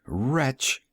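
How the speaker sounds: usual speaking voice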